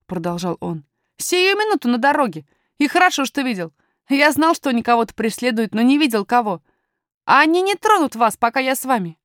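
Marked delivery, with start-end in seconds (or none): 1.2-9.2 put-on higher, lively boy's voice; confident young miner talking to strangers; assured little lad, pitched up; boy miner, self-assured.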